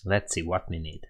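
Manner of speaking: ordinary talk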